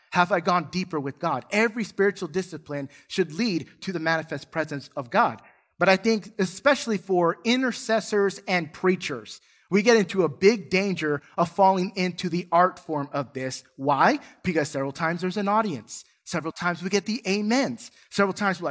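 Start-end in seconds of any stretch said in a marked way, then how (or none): none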